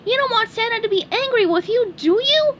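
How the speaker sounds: high, anxious cartoon sponge plea; worried SpongeBob. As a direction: warn him, alarmed.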